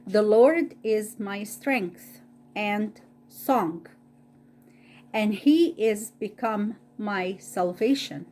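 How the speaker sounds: ordinary delivery, nothing marked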